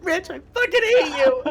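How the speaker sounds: very high, loud acted anger; goblin fury. Goblin thrown in with the captive, furious and high. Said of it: "he goes".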